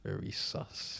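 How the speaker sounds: whispered under the breath; sly whispered aside; teasing whisper. Real, not acted. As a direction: breathe it out as a joke. Whispered tease.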